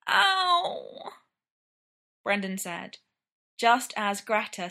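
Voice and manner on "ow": sudden high-pitched ow; pained yelp. A narrator's reading. Brendan as Ryan tightens the laces, yelping in pain. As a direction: yelp as if pinched.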